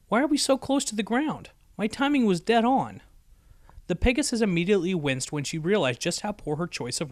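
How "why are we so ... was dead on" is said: high, alarmed disbelief; rattled voice climbing up; startled, higher. A narrator's reading. Dash startled to find herself near the ground, high and alarmed.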